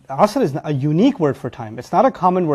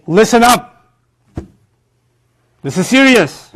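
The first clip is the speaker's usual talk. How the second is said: loud, raised, emphatic command; urgent attention call; 6 semitones higher, 5 dB louder, slower than usual. Acted. The oath tells listeners to take heed, loud and urgent. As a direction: demand the room's attention. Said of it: "almost to say".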